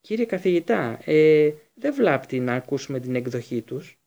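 plain reading voice

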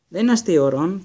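scolding. A narrator's reading. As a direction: tell him off firmly. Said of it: "τον αποπήρε". (αυστηρή επίπληξη, πιο δυνατά)

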